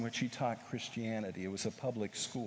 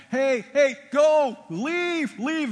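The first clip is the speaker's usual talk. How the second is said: high, loud yell to drive them out; yelling students; 13 dB louder, slower than usual. Retold or imitated. Students yelling at latecomers to leave, a loud high shout.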